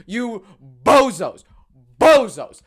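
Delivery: loud insult shouted at rival fans; furious outburst at the bozos; angry yell. Real, not acted.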